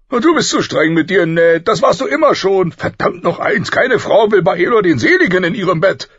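high, loud, exasperated royal voice; blustering king. A narrator's reading. King chiding his friend for being too strict, blustering. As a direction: scold heartily at volume.

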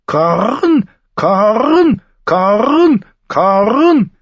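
high squawked caw, rising sharply; shrieking raven. A narrator's reading. Raven screaming as man and wolf fight, high shrieked caws. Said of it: "Mormons Rabe flatterte über ihnen, schrie".

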